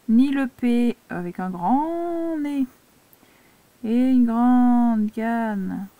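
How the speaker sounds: very slow, vowels stretched out long; exaggerated drawn-out words. Shown as a demonstration. Teacher showing a pupil oversized letters to avoid, exaggerated and drawn out. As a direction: draw the words out like the oversized strokes.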